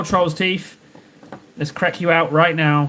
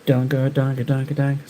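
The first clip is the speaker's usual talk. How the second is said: alien sounds; 2 semitones lower, 3 dB quieter than usual. Pretend. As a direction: chant like a beamed-down alien. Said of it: "Sounds like aliens beamed down".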